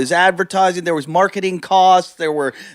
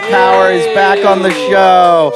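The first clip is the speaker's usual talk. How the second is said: announcer hype; 4 semitones higher, 7 dB louder than usual. Acted. Host announces returning guest.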